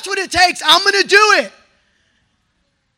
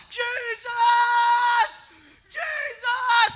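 high, desperate cry of the name; reliving a cry, high and pleading; 9 semitones higher, 6 dB quieter, slower than usual. Retold or imitated. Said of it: "And I'm crying out".

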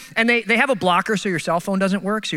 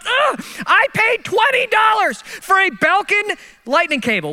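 mock outrage; 10 semitones higher, slower than usual. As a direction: spit out the price like it hurts. Outraged at airport prices.

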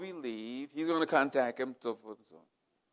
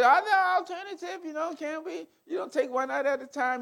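imitating his wife; 12 semitones higher than usual. Wife pleading with the surgeon.

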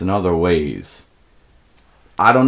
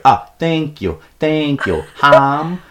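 raised, bad Mexican accent; poor fake Mexican accent; 6 semitones higher, 3 dB louder, much slower than usual. Storyteller imitates friend thanking the server, poor fake Mexican accent. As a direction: thank someone in a badly faked Mexican accent. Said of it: "poor fake Mexican accent".

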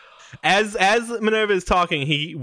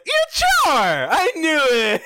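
put-on excited little girl; 10 semitones higher, slower than usual. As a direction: shriek it like a child who caught him. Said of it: "Minerva's like".

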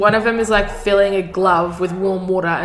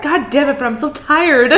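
higher, louder laughing gripe; lightly voiced tired cook, laughing; mock exhausted complaint; 7 semitones higher, 5 dB louder than usual. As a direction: ham up being worn out. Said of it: "He's like".

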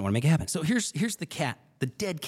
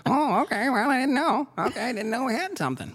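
put-on high, quavering little-old-lady voice, much higher; 10 semitones higher than usual. Mimicking a colleague as a flustered old lady, high and quavering. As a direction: play a flustered old lady who didn't know. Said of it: "Old lady".

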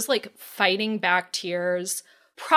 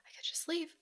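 hushed whispered confession; 7 semitones higher, 18 dB quieter, faster than usual. Retold or imitated. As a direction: whisper it as if tempted to escape. Retelling urge to flee the line.

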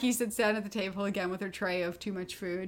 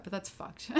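whispered aside; 13 dB quieter than usual. Real, not acted. Muttered aside about sororities.

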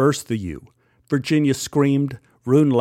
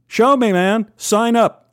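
higher, louder daring challenge; a hint of a friendly dare to the teller; challenging; 8 semitones higher, 5 dB louder than usual. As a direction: dare him to prove it. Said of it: "Rune challenged".

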